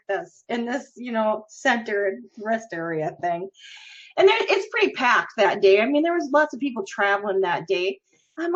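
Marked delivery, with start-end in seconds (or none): none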